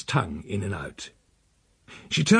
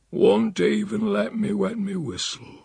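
put-on grumbling old uncle; 8 semitones higher than usual.

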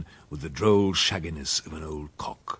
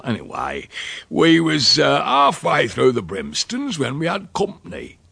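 put-on rustic old uncle, boastful; 2 semitones higher than usual. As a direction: spin the tall tale with relish.

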